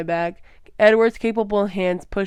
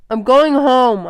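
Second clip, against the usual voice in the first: loud and high, cracking on the words; breaking shout; 6 semitones higher, 12 dB louder, slower than usual. A narrator's reading. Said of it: "I shouted, my voice breaking".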